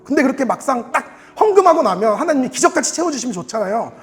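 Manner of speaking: ordinary delivery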